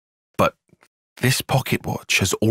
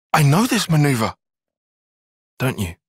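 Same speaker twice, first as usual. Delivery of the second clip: ordinary talk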